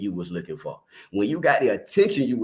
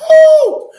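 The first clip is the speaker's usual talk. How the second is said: loud high whoop; shouted high woo; 15 dB louder than usual. Real, not acted. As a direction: let out a big high "woo".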